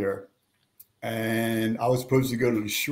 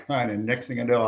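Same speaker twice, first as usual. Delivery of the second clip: ordinary delivery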